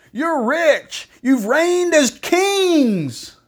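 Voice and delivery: high, stretched-out mock awe; sarcastic. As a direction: taunt with drawn-out false praise. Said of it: "He's being sarcastic".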